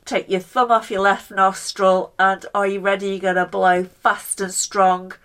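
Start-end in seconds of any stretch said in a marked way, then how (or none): none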